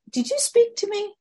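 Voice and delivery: surprised he's talking to a frog; old man startled by a talking frog; embarrassed old man. Acted. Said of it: "But he says".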